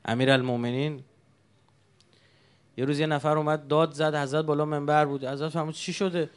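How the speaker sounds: ordinary delivery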